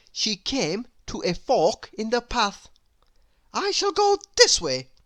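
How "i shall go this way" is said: higher, louder, falsely bright; stubborn girl, pretending. A narrator's reading. Little Miss Stubborn pretends the fall was fun, high and bright. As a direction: brush it off brightly. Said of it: "she said".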